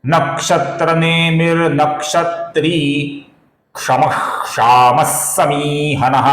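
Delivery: slow measured verse chant; slow Sanskrit shloka chant; shloka recitation. Shown as a demonstration. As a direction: recite slowly, syllable by syllable. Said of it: "संपूर्ण श्लोक का उच्चारण देखते हैं".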